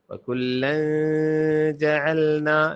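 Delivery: وکلن جعلنا with a long hum after the l; ghunna. Shown as a demonstration. Teacher models it for learners, long nasal hold in وکلن. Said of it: "لام پہ غنہ کریں".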